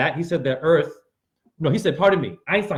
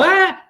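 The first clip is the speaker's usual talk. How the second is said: pained yelp; 5 dB louder than usual. Retold or imitated. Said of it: "A lot of you go".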